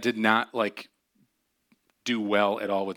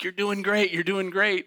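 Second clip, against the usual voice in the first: cheery therapist; 8 semitones higher than usual. Retold or imitated. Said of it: "she's like".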